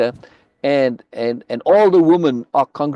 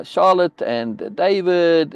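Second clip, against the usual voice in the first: ordinary talk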